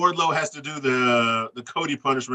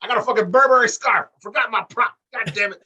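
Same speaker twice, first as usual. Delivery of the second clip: pitched-up excitement at the forgotten prop; excited high fluster; 8 semitones higher, 4 dB louder than usual. Real, not acted. Host realizes he forgot his prop, flustered and high. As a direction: blurt it out higher, all worked up.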